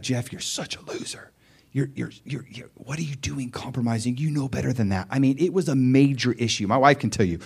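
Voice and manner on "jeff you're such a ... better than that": hushed, bitter whisper at himself; self-condemning whisper. Retold or imitated. Preacher hushing his inner critic's whisper. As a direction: whisper the put-down to yourself.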